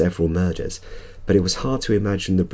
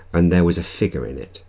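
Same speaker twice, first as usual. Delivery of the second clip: ordinary delivery, nothing marked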